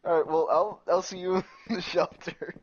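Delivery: ordinary delivery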